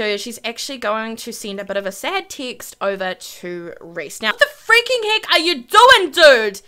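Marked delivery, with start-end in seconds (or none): 4.2-6.6 high, loud disbelief; exasperated outburst. Real, not acted. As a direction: cry out at someone's baffling behaviour. Reacting to chaos in the game.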